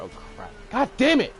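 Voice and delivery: sudden high frustrated yell; angry outburst. Real, not acted. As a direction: snap out in frustration. Sudden in-game mishap.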